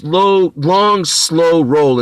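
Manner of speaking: slow, stretched words; dragging it out slowly; drawn out. Retold or imitated. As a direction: stretch each word like a slow roll. Words stretched to mimic slow car.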